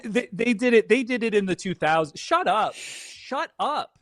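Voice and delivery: ordinary talk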